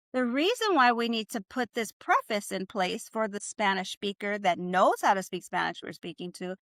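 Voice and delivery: ordinary talk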